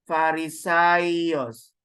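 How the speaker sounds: Farisaios said very slowly, syllable by syllable; slow demo word. Shown as a demonstration. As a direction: draw Farisaios out so the class can follow. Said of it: "teaching the Greek word".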